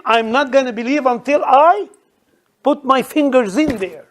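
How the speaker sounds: high, loud, emphatic refusal; defiant doubter. Acted. Preacher voices Thomas refusing to believe, defiant and loud.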